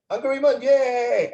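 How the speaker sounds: high, drawn-out student's yay; excited cheer. Retold or imitated. Student telling his tutor of a good exam score, excited cheer. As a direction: imitate an overjoyed pupil cheering.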